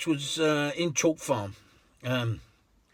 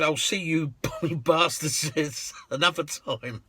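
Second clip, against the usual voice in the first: slow Aussie-tinged farewell with laughs; Merv's farewell; 2 semitones higher, slower than usual. Retold or imitated. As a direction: tease them warmly as you leave. Merv's cheeky parting line.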